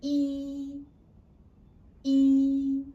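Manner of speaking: E sustained loudly at a high, even pitch; high flat tone. Shown as a demonstration. Teacher has pupils repeat after her, high flat tone. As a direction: hold each E high and level. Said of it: "flat tone is a high-pitched tone".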